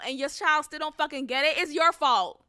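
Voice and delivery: usual speaking voice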